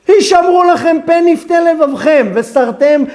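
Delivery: rapid rattled-off scripture reading; קריאה מהירה של הפסוק; fast Hebrew recitation. Shown as a demonstration. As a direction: race through the verse by rote. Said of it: "What did I just read to you here".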